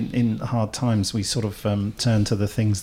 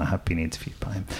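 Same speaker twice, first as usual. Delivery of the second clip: whispered aside; 4 semitones lower, 8 dB quieter, faster than usual. Real, not acted. Jokey hushed aside.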